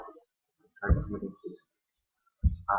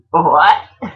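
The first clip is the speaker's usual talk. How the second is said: loud, high shocked yelp; a startled, loud rising what; 7 semitones higher, 12 dB louder than usual. Retold or imitated. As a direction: blurt it out stunned. Shocked reply to mom's answer.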